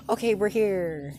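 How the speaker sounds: high and loud, bright arrival call; loud cheery announcement. Acted. Uraraka announcing to everyone they've arrived, loud and high.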